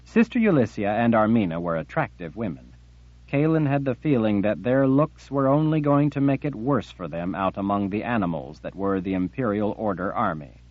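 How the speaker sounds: plain reading voice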